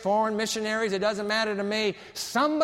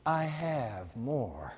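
ordinary delivery

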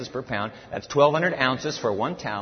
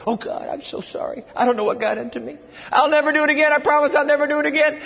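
blubbering servant; 8 semitones higher than usual. Retold or imitated. Sinner blubbers bargains to God, high frantic begging. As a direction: gush promises at God in a high panic. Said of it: "sound like the very same blubbering servant".

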